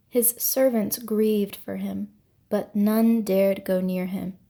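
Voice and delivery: usual speaking voice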